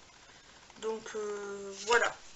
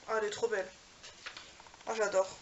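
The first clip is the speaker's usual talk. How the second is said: normal talking voice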